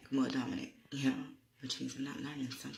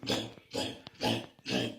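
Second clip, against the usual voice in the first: booming heart imitation; heartbeat boom; 4 dB louder than usual. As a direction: mimic a pounding heartbeat. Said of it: "the light in my chest is like".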